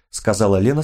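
plain reading voice

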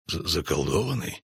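low, soft grumble with a stumble; grumbling stammer. A narrator's reading. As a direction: mutter it low, tripping on the first sound. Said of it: "проворчал Эйст". (тихо ворчать, запинаясь)

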